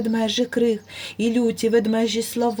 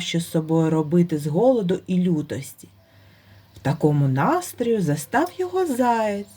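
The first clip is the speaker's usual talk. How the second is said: usual speaking voice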